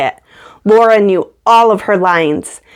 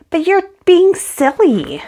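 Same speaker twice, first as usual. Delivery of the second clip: put-on higher girl's voice, mildly scolding; Laura scolding; 9 semitones higher than usual. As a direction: tell her off like an exasperated friend.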